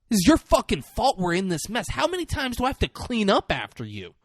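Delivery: high-pitched acted complaint; mock exasperated blame. Farrell blaming his partner for the mess, mock exasperation. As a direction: lay the blame with rising exasperation.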